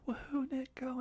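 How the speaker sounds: cheering. Retold or imitated. Family cheering at the finish.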